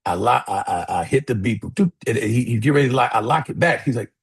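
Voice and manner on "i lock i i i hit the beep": ordinary talk, nothing marked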